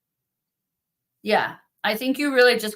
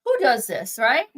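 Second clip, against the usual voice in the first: put-on incredulous girl; 8 semitones higher than usual. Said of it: "I'm like".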